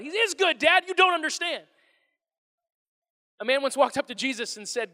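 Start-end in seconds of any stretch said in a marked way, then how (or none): 0.0-1.6 put-on higher, louder, mildly exasperated kid; frustrated son. Son correcting his dad in the car, mildly exasperated. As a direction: push back, a little fed up. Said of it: "he's getting frustrated with me".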